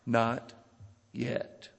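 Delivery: soft, low, with a long pause between words; quiet refusal. Retold or imitated. Master answering the pleading teacup in the oven, quiet refusal. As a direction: let each word drop gently and separately. Master's patient refrain in parable.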